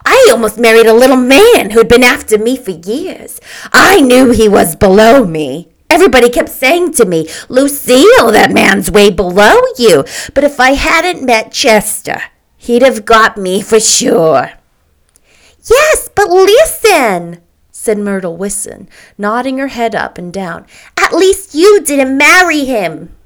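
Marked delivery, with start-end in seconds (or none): 0.0-14.5 loud, higher-pitched, vigorous woman; shrill, self-satisfied declaring; shrill Mrs. McKee. A narrator's reading. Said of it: "the shrill voice of Missus McKee". 15.7-17.4 high, loud, drawn-out interruption; slow, emphatic protest; insistent. A narrator's reading. Myrtle insists on being heard. 21.0-23.1 slow, loud, higher-pitched complaint; emphatic aggrieved protest; insistent. A narrator's reading. Myrtle argues her case.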